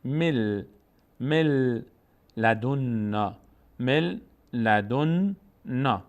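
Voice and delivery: slow مل لدنا with doubled l; n merged into lam. Shown as a demonstration. Teacher models the merger, مل ending on held l. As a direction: hold the l of مل into لدنا, no n.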